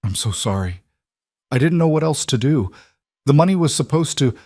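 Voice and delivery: a father sobbing his apology. A narrator's reading. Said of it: "A sob".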